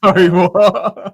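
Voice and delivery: stunned laugh at the news; laughing disbelief. Real, not acted. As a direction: caught off guard, laughing in surprise. Shocked reaction to Fat Freddy.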